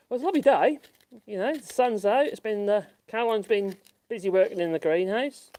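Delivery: chirpy hello to viewers; cheery vlog voice. Real, not acted. Lively vlog greeting.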